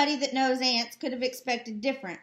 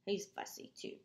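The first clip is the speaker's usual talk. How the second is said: ordinary delivery, nothing marked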